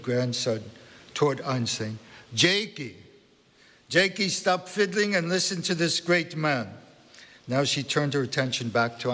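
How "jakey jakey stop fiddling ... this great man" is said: put-on high scolding in a Central European accent; bossy Central European grandmother. A narrator's reading. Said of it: "in a strong Central European accent".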